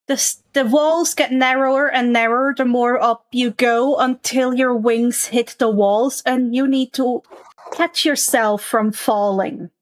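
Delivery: ordinary talk, nothing marked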